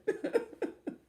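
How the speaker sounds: high breathy giggle; real short giggle; high airy laugh. Real, not acted. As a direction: giggle at the story. Laughing at own story.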